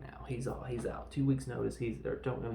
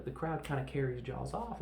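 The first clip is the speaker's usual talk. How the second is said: ordinary delivery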